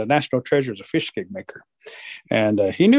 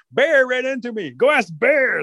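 high-pitched storybook wolf; pitched-up, hurried answer; excited animal character; 10 semitones higher than usual. Acted. Wolf passes the blame to Bear, excited.

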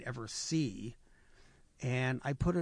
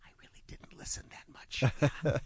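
whispered aside with a wink; conspiratorial whisper. Retold or imitated. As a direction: let it out under your breath, slyly. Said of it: "he kind of looked at me and winked like".

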